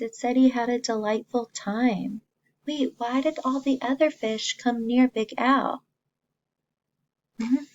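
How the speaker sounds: ordinary delivery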